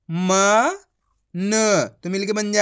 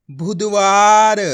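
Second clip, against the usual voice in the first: बुधवार drawn out and loud; teacher blending the word; slow loud blended word; 8 dB louder, much slower than usual. Shown as a demonstration. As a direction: stretch बुधवार out so children follow. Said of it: "direct मात्रा का उच्चारण".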